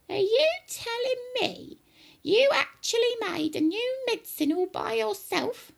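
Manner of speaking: very high, incredulous granny voice; high-pitched grandma disbelief; shouting grandma. Acted. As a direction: demand it shrilly as an old woman. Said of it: "she shouted".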